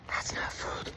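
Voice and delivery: breathy unvoiced whisper, half to himself; whispered aside. Muttering to himself about a patient's muffin, whispered. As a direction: hush it like a private dig at the snack.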